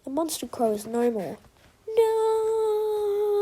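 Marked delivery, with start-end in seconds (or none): 2.0-3.4 long, high-pitched villain's "no"; drawn-out storybook cry of defeat; anguished cry. A narrator's reading. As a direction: wail it high and hold it. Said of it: "cries Jungle Crow".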